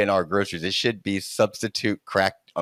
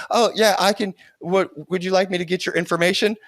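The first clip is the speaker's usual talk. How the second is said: mock customer-service voice, much higher; eager helpful dealer; 9 semitones higher than usual. Ten-year-old plays dealer to a car buyer, chirpy and high. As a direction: play the keen intern taking orders.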